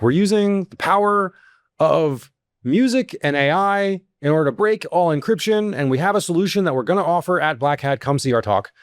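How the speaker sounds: high, grand promo voice for a snake-oil talk; hammed-up conference pitch; mock hype pitch. Mock sales pitch from talk lineup.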